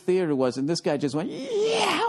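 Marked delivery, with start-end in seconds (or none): 1.3-2.1 excited yell. Retold or imitated. Guy yelling while flying by.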